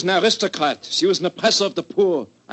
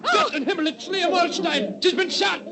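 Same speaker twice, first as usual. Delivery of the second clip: much higher, alarmed exclamation; shocked cry; 12 semitones higher than usual. Acted. Man at the ball sees the actress shot, high shocked cry. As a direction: gasp it out in horror.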